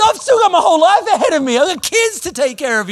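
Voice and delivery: loud, high-pitched pleading rant; acted frantic protest. Nervous flyer with kids to take care of, loud frantic protest. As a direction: plead your case at full volume, hammed up.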